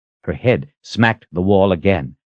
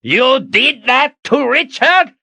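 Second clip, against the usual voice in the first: high, slow outraged demand; wild fury; 4 dB louder, slower than usual. A narrator's reading. Zed wild-eyed in front of Kalen, enraged. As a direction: drag out each word in outrage. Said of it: "his eyes wild".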